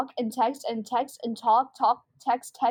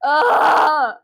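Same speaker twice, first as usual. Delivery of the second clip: frustrated shout; 3 semitones higher, 11 dB louder than usual. Acted. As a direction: let it burst out, fed up. Callie snaps at the ringing.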